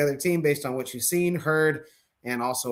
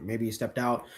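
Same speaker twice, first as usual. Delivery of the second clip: ordinary talk, nothing marked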